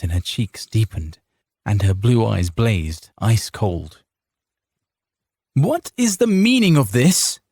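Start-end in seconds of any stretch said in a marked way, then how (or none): 5.5-7.4 high, sharp demand; demanding wife. A narrator's reading. A wife demanding answers at her gate, indignant. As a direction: Carita confronts the riders, outraged. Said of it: "she demanded".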